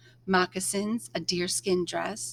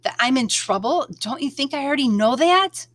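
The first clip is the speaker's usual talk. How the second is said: louder, raised angry protest; 4 semitones higher, 7 dB louder than usual. A narrator's reading. Girl protests angrily to the rabbit, louder. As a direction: push back hard at the rabbit. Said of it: "clenching my fist".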